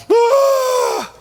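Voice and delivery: sudden very high shouted cry of exasperation; frustrated cry. Retold or imitated. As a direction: let out a loud fed-up yell. Mock frustrated outburst.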